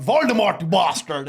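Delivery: high, exclaimed fake Indian accent; mock Indian accent. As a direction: call it out in a put-on Indian accent. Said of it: "We all do accents and whatever".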